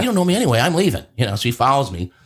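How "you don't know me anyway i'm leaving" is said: high, miffed reply; pitched-up sulky retort; snapping back. Retold or imitated. Telling the buddy who ignored him he is leaving, miffed. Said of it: "I said".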